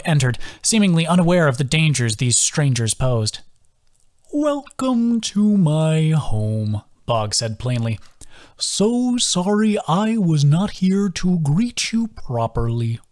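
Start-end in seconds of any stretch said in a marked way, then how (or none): 4.3-6.8 put-on slow, high, lilting old creature; slow sing-song voice of a gentle old turtle. A narrator's reading. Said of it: "His sing-song voice". 8.6-13.0 put-on slow, high, lilting old creature; slow sing-song voice of a gentle old turtle. A narrator's reading. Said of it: "His sing-song voice".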